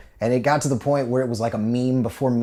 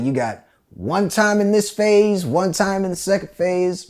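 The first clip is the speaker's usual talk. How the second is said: higher, louder sergeant threat; drill sergeant; 7 semitones higher, 4 dB louder, slower than usual. Retold or imitated. Sergeant setting terms for the recruit, menacing. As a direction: lay down the rules with menace. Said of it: "He's like".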